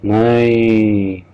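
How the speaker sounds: long, drawn-out cry of no; scream. Acted. Falling man crying out as he drops, drawn-out scream. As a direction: stretch the no into a falling man's wail. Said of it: "फिर वो चीख उठा".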